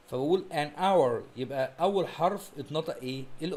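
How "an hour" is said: slow an hour, h dropped, en awer; careful pronunciation. Shown as a demonstration. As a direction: spell it out by sound, no h in hour. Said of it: "ال H فيها silent". (نطق an hour ببطء والـ h صامتة)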